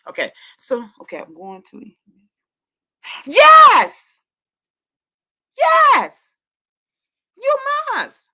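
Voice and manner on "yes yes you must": very high, loud squeal of joy; shrieking with delight; ecstatic shout. Real, not acted. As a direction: scream it out in celebration. Overjoyed at the news.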